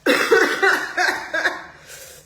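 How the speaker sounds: high loud laugh into a cough. Real, not acted. Host still laughing at viewers, loud and high. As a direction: laugh until you cough.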